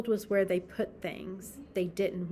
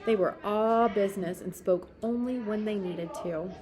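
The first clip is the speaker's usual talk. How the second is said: plain reading voice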